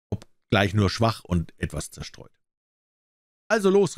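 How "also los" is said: impatient call. A narrator's reading. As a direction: hurry him up, annoyed. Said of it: "rief der König ungeduldig".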